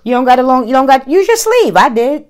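high, loud and quick; high excited teasing. Real, not acted. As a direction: tease her, high and fast. Teasing about using sleeve.

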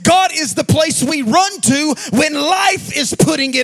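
loud, slow, sung-out preaching cadence; full-force preaching. Acted. Pastor preaching to the congregation, loud sung-out cadence. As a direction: preach it out over the music.

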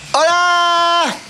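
high, bright shouted greeting; excited show greeting; excited hello. Acted. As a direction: burst in with a big hello. Hosts opening the episode.

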